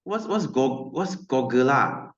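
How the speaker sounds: ordinary talk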